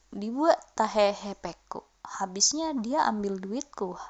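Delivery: slow whisper of the excuse. Shown as a demonstration. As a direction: mutter the excuse under your breath. Example excuse said under breath.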